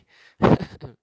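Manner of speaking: quick burst of laughter; short laugh. Real, not acted. Preacher teasing married viewers, short laugh. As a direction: laugh briefly at your own remark. Chuckles after teasing advice to husbands.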